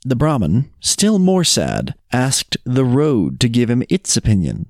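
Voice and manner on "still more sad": plain reading voice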